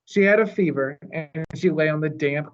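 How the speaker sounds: ordinary delivery, nothing marked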